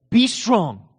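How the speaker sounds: a mild mock stern command; commanding God. Preacher voicing God's harsh command, mildly stern. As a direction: bark the order like an impossible demand. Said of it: "Well, that just seems cruel".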